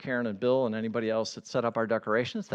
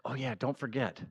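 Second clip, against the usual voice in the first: soft repeated nudge to yourself; softly repeated self-reminder; quiet reminder; 7 dB quieter than usual. Retold or imitated. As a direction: murmur it like a note you keep rereading. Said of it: "I'm like".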